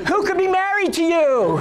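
high, indignant disbelief; a hammed-up appalled wife. Wife glaring at her husband across the dinner table, high appalled disbelief. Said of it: "my wife looks at me like".

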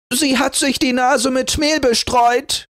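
screaming. A narrator's reading. Jealous sister accusing Rotnas, angry shriek. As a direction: spit out the accusation. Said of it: "schrie sie".

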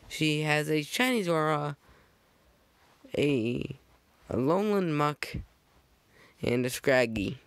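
usual speaking voice

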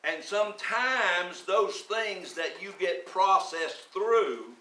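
slow, emphatic preaching. Acted. Pastor exhorting the congregation, slow and emphatic. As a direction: press each phrase home firmly.

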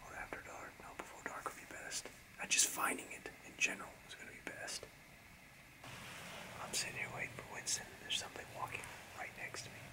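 hushed hunter's whisper. Real, not acted. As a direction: whisper so the deer won't hear.

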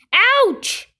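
high, loud "ouch"; yell of pain. A narrator's reading. Giant falls over stone, yelling. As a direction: yelp as if hurt. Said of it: "he yelled".

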